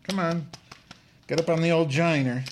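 high-pitched pet-talk voice; coaxing a pet. Real, not acted. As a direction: coax the animal up in a high voice. Coaxing pet Trotsky to climb up.

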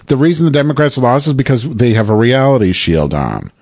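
ordinary talk, nothing marked

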